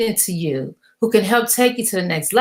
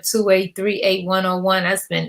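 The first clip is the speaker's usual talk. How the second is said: ordinary talk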